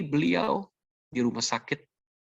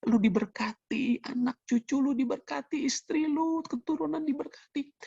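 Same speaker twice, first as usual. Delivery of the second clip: imitating tearful blessing. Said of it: "Setiap kali dia nangis, dia bilang".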